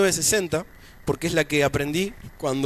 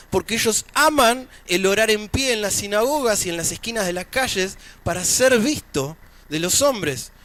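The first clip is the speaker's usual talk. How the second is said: higher-pitched, projected sermon delivery; preaching; 8 semitones higher, slower than usual. Acted. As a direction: preach it in measured, emphatic phrases with pauses. Preaches to the congregation.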